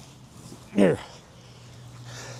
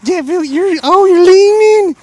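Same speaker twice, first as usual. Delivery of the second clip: much higher and louder excited squeal; squealing delight; 12 semitones higher, 11 dB louder than usual. Real, not acted. Dog leans in, excited cooing.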